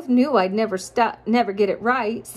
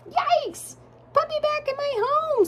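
tiny, much higher squeak of a startled mouse; squeaky mouse. Acted. The mouse protests being dug up, high squeaky voice. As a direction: squeal it like a frightened little mouse. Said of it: "said the mouse".

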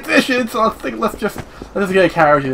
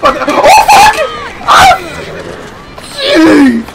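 startled yelp; 4 dB louder than usual. Retold or imitated. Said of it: "I was like".

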